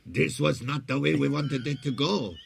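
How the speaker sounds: put-on loud French Canadian and German mix accent; cross a French Canadian with German; Chrétien impression. Impressing the prime minister's speech, loud mixed accent. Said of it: "the Chrétien punch thing".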